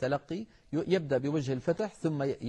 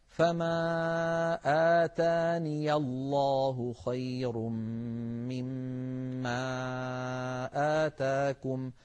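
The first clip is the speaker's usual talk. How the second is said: slow chanted tajwid recitation; slow melodic recitation of the verse; Quran recitation; much slower than usual. Shown as a demonstration. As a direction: draw out each word in measured chant.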